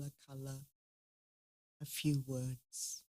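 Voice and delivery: soft, unhurried meditation guide; guided meditation. Acted. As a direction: gently lead the group inward.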